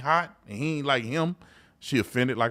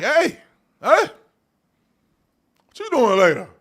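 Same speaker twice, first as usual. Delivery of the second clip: high, eager flirting call; flirty pickup line; 11 semitones higher, 7 dB louder than usual. Retold or imitated. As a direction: call out to a fine woman, playing it up. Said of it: "I'd have been like".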